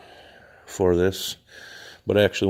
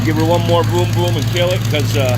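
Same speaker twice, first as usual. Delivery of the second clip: raised, higher voice over motor noise; shouting over engine; 10 semitones higher, 7 dB louder than usual. Real, not acted. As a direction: project hard to be heard above the engine. Talking over a running engine.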